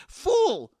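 a sudden high, loud yell; furious shout. A narrator's reading. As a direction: spit it out at the top of your voice. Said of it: "Puss shouted".

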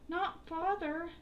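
high, slow desperate cry; frightened scream. A narrator's reading. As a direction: cry out in terror. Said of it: "cried as he screamed".

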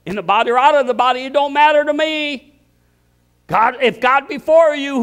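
fast-then-ringing pulpit shout; full-force preaching. Acted. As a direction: preach it out at the top of your voice.

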